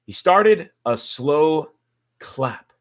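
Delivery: started a slow clap, drawn out and loud; slow emphatic repeat. Acted. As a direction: stretch each word for emphasis.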